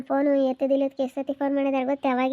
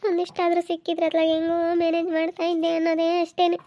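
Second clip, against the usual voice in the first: higher, tearful complaining woman; upset village woman; 4 semitones higher than usual. Acted. Woman pouring out her grief to her sister, higher and tearful. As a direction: lament the lost property to her sister. Second woman laments lost property.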